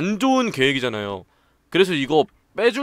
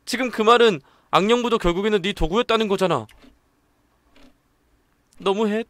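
put-on upset girl; 7 semitones higher, 4 dB louder than usual.

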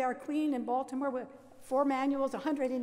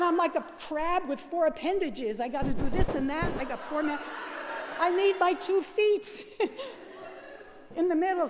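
high, loud, laughing through it; laughing retort; 4 semitones higher, 4 dB louder than usual. Retold or imitated. Nun to nervous teacher, explaining with laughter. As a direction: giggle while explaining, raised voice.

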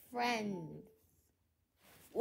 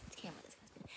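whispered aside; 6 semitones lower, 17 dB quieter, much faster than usual. Real, not acted. Muttered aside about camera.

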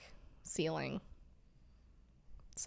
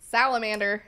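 loud, raised-pitch shout; playful blurt; 4 semitones higher, 11 dB louder than usual. Pretend. Host blurts joke trivia answer, playful shout. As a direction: call it out like a quiz answer.

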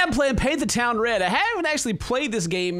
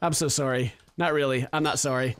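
mock apology; 9 semitones lower than usual.

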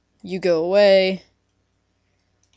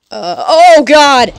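loud startled yelp; startled shout; 7 semitones higher, 12 dB louder, much slower than usual. Real, not acted. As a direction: caught off guard, shout it. Creeper explosion surprise.

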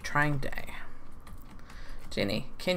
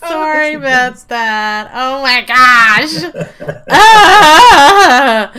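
laughing; 5 semitones higher, 14 dB louder, much slower than usual. Real, not acted. After laughing fit.

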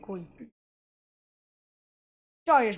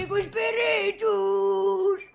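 hammed-up call; 7 semitones higher, 5 dB louder, much slower than usual. Playful calling out.

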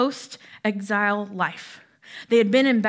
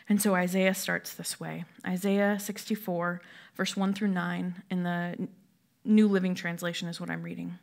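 usual speaking voice

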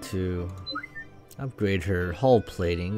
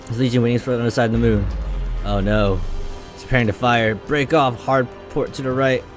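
loud, raised-pitch combat callouts; urgent battle dialogue; 5 semitones higher, 9 dB louder than usual. Acted. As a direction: read the crew's orders loudly under fire. Reads battle dialogue aloud.

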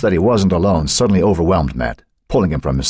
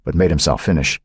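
usual speaking voice

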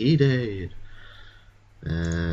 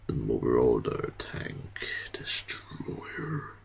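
low, slow whisper like a trailer; dramatic whisper; 8 semitones lower, 6 dB quieter, much slower than usual. Acted. Gamer naming his tank in a low slow trailer whisper. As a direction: breathe the name dramatically.

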